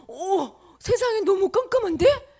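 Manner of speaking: high, loud, drawn-out amazement; startled. Acted. People seeing the world go dark at noon, startled. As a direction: gasp and exclaim in alarm.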